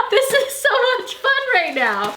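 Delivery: very high, giggly and loud; laughing excitedly. Real, not acted. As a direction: laugh through the words in delight. Laughing while opening another book.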